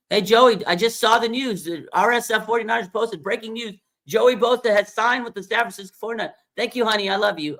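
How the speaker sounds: high, doting mom voice, pleading sweetly; Cheryl, Joey's mom. Retold or imitated. Mom calling son Joey to sign, high doting plea. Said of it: "she's gonna call him on Monday".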